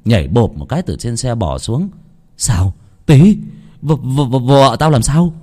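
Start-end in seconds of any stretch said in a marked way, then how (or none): 2.4-5.3 startled, stammering question with a sudden loud call; alarmed. A narrator's reading. Ba Tửng asks Tý about his wife, alarmed. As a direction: stumble over the first word in alarm. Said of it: "Ba Tửng hốt lắm".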